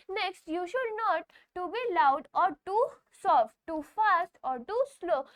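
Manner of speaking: reads the rule slowly, word by word; slow reading aloud; slow textbook reading. Shown as a demonstration. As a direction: dictate it slowly for students. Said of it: "reads textbook rule".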